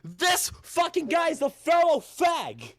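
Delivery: high, slow, shouted accusation; hammed-up scandalised yell; mock-outraged shout. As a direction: holler it out like a public denunciation.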